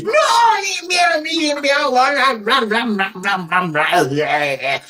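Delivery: high wailing nonsense, falling away; hammed-up high gibberish whine that winds down; mock complaining babble. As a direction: mimic an endless moan as babble. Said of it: "That's how I felt it was in my mind".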